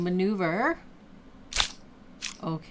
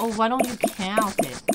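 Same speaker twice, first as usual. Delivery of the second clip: normal talking voice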